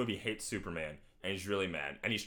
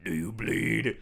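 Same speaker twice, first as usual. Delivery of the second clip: louder, rough menacing growl; Batman; 5 dB louder, slower than usual. Acted. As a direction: growl it like a grim vigilante. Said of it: "he just goes".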